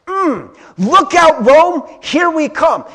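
high, loud gleeful crowd cheer; excited crowd quote. Retold or imitated. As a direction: cheer like a crowd sure of winning. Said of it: "And they're like".

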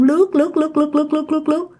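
high, soft monotone chant; quick, even, floating repetition; eerie gliding repetition. A narrator's reading. Imitating the ghost gliding away.

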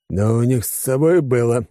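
plain reading voice

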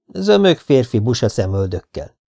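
usual speaking voice

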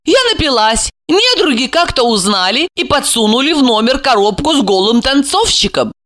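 high, insistent character voice; defensive woman. Acted. Voices Alexandra defending herself.

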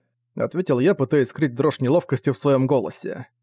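usual speaking voice

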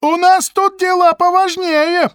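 very high, loud, slow insistence; pushy shout. Acted. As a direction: lean on them hard. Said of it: "попытался навалиться Г П Ушник". (напористый крик)

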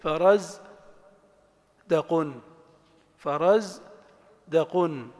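فرز, long pause, then دقن; syllable by syllable. Shown as a demonstration. Teacher matching an example to the pattern, syllable by syllable. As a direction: break the word into فرز and دقن, two separate beats. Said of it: "حاول تعتمد دائمًا على التنغيم الصوتي".

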